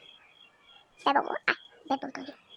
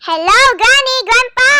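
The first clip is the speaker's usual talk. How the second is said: loud, higher greeting call; 6 semitones higher, 4 dB louder than usual. Real, not acted. Calling Granny and Grandpa through the house, loud.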